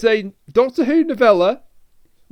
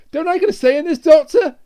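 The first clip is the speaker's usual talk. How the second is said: higher, indignant acted plea, rising louder; protesting companion; 7 semitones higher, 6 dB louder than usual. Peri objecting to the Doctor, indignant protest. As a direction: protest it, punching the last word. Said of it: "'Cause she even says".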